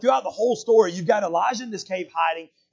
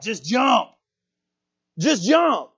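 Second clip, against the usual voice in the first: higher, louder mock shout; 5 semitones higher, 6 dB louder, much slower than usual. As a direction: imitate a shouted urging. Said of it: "there's a voice that shouts out".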